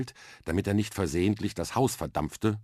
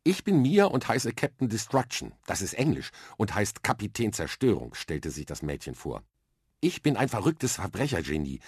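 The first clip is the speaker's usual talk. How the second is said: put-on higher, bright-eyed girl's voice, matter-of-fact; a confident little girl explaining her plan; lively young girl; 3 semitones higher than usual. Girl with a bucket on her head explains space training to Arthur, eager and matter-of-fact. Mia introduces herself.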